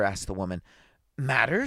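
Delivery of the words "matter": plain reading voice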